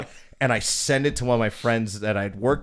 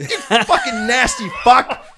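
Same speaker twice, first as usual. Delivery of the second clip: high, loud, slow laughing insult; laughing disgust; 13 semitones higher, 10 dB louder, slower than usual. Retold or imitated. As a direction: spit it out cracking up in disgust. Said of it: "Just like".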